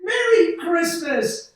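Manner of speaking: high, slow, overjoyed yell; joyful shout. Acted. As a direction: shout the greeting to the whole town. George runs home overjoyed.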